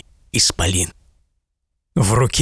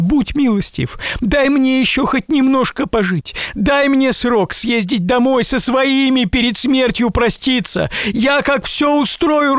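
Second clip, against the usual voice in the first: high, pleading lament; tearful merchant's plea; 13 semitones higher than usual. A narrator's reading. Merchant begging the giant to let him see his family, high tearful plea. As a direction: beg to say goodbye to family.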